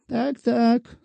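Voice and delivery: a whining widow threatening with her rights; defenceless petitioner. A narrator's reading. Shchukina voiced by narrator.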